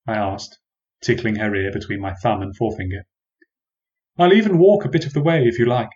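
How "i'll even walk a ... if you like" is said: offering. A narrator's reading. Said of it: "I offered".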